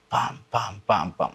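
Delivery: spoken bang-bang gunshot imitation; imitated gunfire. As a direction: voice separate shots as short syllables. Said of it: "מקבלים מטח יריות". (חיקוי קולות ירי)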